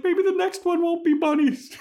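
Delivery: a chirpy high-pitched put-on girl voice; squeaky Anya. Podcaster voicing Anya's cheerful reply, squeaky and high. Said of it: "She's like".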